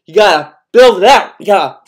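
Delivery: energetic push. Real, not acted. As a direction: pump it up. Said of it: "put some energy".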